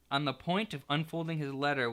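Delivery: usual speaking voice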